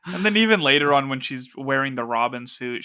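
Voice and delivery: normal talking voice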